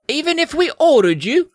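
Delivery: put-on very high boyish character voice; squeaky, insistent question; imploring young prince. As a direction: ask as a hammed-up hopeful prince. Young prince pleads with wizard.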